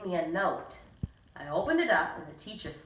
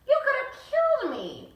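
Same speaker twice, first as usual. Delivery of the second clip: put-on much higher, indignant girl; shrill outrage; 4 dB louder than usual. A girl hit by a notebook, outraged and much higher. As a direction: squeal it, outraged. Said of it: "Amy Lou said".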